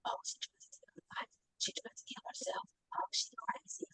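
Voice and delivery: hushed gossiping stage whisper; nurses whispering. Retold or imitated. Nurses gossiping about a patient outside the door, whispered. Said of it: "I heard the nurses whispering".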